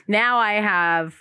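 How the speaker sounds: ordinary delivery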